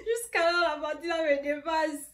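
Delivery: crying with laughter; laughing tears. Real, not acted. Remembering the moment, crying with laughter.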